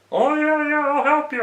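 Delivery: a mocking imitation of a coach who brushes you off; mocking dismissive coach. Mocking the coach who plays favourites.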